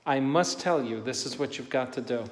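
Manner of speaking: ordinary delivery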